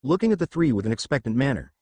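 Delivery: plain reading voice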